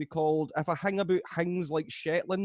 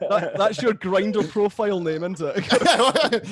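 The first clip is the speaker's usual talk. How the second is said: louder, laughing through the jab; laughing tease; 3 semitones higher, 8 dB louder, slower than usual. Real, not acted. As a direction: tease him while laughing out loud. Teasing co-host, laughing loudly.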